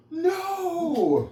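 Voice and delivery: high, loud, laughing bark of no; mock angry shout. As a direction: snap back at the wife in mock temper. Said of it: "And I go".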